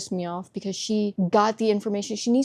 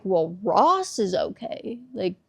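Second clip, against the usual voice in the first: slow bad news; 4 dB louder, slower than usual. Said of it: "They're like".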